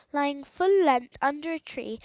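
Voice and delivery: ordinary delivery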